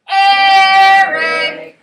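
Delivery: loud, high, long sung-out name; calling to dinner. Shown as a demonstration. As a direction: holler the name as if calling a child in. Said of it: "Call it to dinner".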